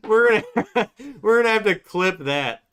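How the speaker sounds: giggling, can barely get it out; laughing. Real, not acted. Host cracking up at the dog on stream, laughing.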